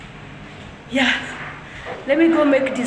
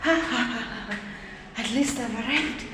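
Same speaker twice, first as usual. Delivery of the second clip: slow, exhausted, quiet relief; tired sigh; 9 dB quieter, much slower than usual. Real, not acted. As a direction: sigh it out, worn out. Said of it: "so tired".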